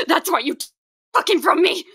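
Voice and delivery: loud, high outburst of blame; anguished accusation. Acted. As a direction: hurl the accusation, voice rising. Accuses the doctor of taking partner.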